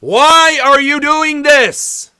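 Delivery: high, slow, loud plea; pleading shout. Acted. Vlogger yelling a plea at Russia, loud and slow. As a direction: cry out at Russia, slowly.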